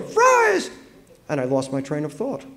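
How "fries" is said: piercing, very high parrot squawk. A narrator's reading. Crow parrots at dinner time, squawking piercingly. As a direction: screech it like a raucous bird. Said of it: "they crowed".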